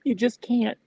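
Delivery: high, strained voice fighting tears; real tearful catch in the throat; choked up. Real, not acted. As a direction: force the words out between long pauses. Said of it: "I'm getting a little choked up".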